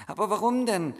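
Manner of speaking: put-on boy puzzled, high and loud; surprised boy. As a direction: ask in disbelief.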